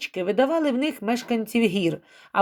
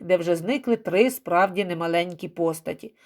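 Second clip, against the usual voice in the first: ordinary delivery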